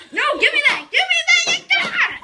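high frantic yelling; scared and angry. Real, not acted. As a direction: shout high, half laughing. Brother grabbing toys again.

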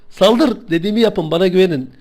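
normal talking voice

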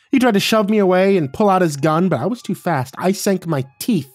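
high, loud, wild excitement; worked-up. A narrator's reading. Delilah recounting how she attacked the man, worked-up. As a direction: relive the attack with mounting intensity.

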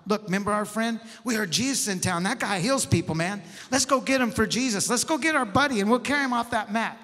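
eager buddies rallying, pitch climbing; rally the friends with growing urgency; fired up. Acted. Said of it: "they got all fired up".

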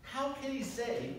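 high, scandalized mock outrage; indignant Pharisee quote, lightly hammed.

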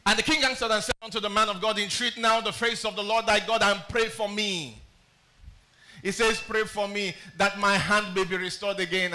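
booming Bible reading; scripture reading. Acted. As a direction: declaim to the congregation.

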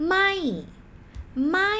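ไม่ said with the pitch rising then falling, Thai tone. Shown as a demonstration. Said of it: "Tone go up and down".

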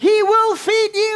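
high, slow, ringing declaration; emphatic preaching. Real, not acted. Preacher promising God's provision to church, emphatic preaching.

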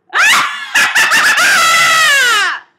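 high, loud, drawn-out villain cackle; evil laugh. Pretend. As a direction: cackle like a theatre witch. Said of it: "wants me to do an evil laugh for you".

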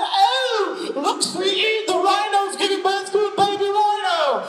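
high, loud, sing-song tourist mom. Retold or imitated. Family at the safari voiced by the pastor, high and sing-song. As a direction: gush like a delighted mom on safari. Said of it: "they're like".

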